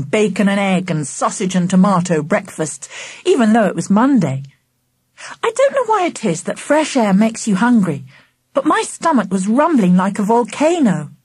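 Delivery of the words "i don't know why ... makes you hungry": ordinary delivery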